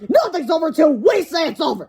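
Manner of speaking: put-on defiant kid refusing to quit; George's kid voice. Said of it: "said George".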